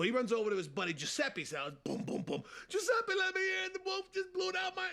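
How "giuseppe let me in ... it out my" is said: panicked pig. Acted. Panicked pig at friend's door.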